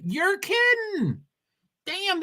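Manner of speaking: high, slow you're kidding, real disbelief; surprised. Real, not acted. Streamer reading rain delay news, surprised. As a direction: caught off guard by the news.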